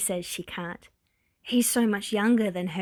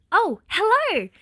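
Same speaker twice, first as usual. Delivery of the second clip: bright, loud, much higher startled greeting; startled exclaim; 11 semitones higher, 6 dB louder than usual. A narrator's reading. Fuyumi, startled by a man behind her, exclaims brightly. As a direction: caught off guard, spin round and greet. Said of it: "she exclaims".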